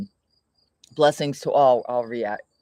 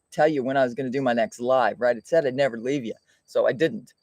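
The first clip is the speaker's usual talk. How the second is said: ordinary talk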